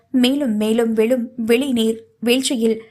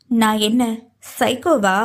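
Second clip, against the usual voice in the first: "நான் என்ன", a pause, then a pressed "Saikhowa"; deliberate, emphatic. A narrator's reading. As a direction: stop before "Saikhowa" and hit it firmly. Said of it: "நிதானமாக நிறுத்தி அழுத்தமாக கேட்டாள்".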